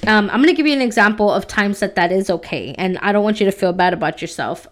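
ordinary delivery

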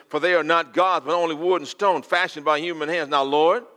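loud, high preached prayer; preaching prayer. Acted. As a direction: call on the Lord at full force.

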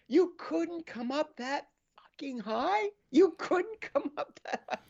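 high-pitched mock disbelief; incredulous fan. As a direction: squeak it out in exaggerated outrage. Voicing an incredulous Canucks fan.

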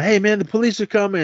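mock call out. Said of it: "someone's yelling out".

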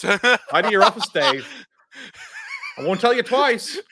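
high, loud mock scolding; stern parent scolding. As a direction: nag him like a cross parent. Mock scolding Dave Meltzer.

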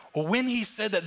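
ordinary talk, nothing marked

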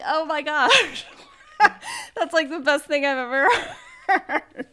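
high, loud, helpless laughter through the words; cracking up. Real, not acted. Retelling niece's hilarious remark to a friend, cracking up. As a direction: talk through bursts of real laughter.

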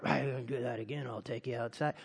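low, quiet tough-guy threat; muttered threat. Acted. Shopper threatens whoever bumped the cart, low muttered threat. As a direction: mutter it low as a menacing warning.

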